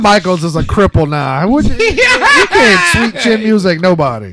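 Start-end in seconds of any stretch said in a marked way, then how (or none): 1.5-4.3 slow, higher, laughing through the words; cracking up while mocking; laughing shout. Real, not acted. Laughing at crippled joke.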